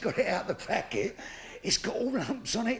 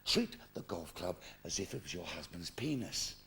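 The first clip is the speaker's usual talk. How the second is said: a much lower, softer, intimate golf pro; gently coaxing; 7 dB quieter than usual. Retold or imitated. Golf pro coaxing Missus Davis, low and soft. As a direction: coax her like a lover.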